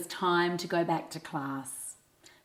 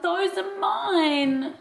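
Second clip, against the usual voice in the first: high, loud and drawn out; child's shout; 13 semitones higher, 6 dB louder, slower than usual. A narrator's reading. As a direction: shout it as a possessive claim. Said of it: "Archie shouted".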